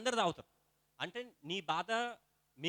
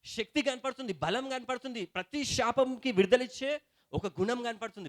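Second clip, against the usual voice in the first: fervent preaching; 5 semitones higher, 5 dB louder than usual. Acted. Pastor rousing the congregation about communion, fervent preaching. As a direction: preach it higher and louder, slowing on key words.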